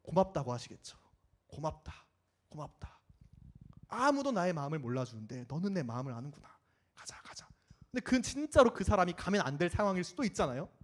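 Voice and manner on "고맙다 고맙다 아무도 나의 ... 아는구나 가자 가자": soft, fading thanks, down to a whisper; tender, hushed gratitude; quiet grateful voice. Acted. (속삭이듯 조용한 감사)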